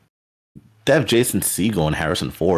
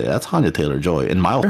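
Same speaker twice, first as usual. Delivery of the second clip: usual speaking voice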